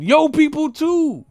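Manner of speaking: high, loud, indignant outburst; exasperated shout. Real, not acted. Calling out UK media over their own people, exasperated shout. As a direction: yell it out to make the point.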